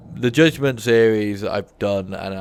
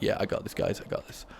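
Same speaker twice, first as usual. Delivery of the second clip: mock calm; 9 dB quieter than usual. Shown as a demonstration. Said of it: "completely calm".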